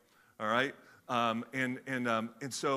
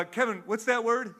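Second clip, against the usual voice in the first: puzzled question; 7 semitones higher, 5 dB louder than usual. Retold or imitated. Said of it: "then he goes".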